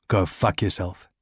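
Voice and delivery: low, quiet contempt; Ricard's voice. A narrator's reading. Ricard to the Gestapo man, low quiet contempt. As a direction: spit it low under your breath.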